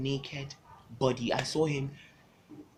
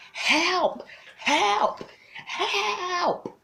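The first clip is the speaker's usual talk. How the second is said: screaming for help; much slower than usual. Retold or imitated. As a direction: yell it for your dear life. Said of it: "I yelled for my dear life".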